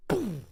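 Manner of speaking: a breathy whispered boom like distant cannon fire; imitated cannon boom. Comic imitates conquistador cannon fire, breathy boom. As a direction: mimic the cannon's blast under your breath.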